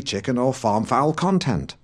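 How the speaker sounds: ordinary talk, nothing marked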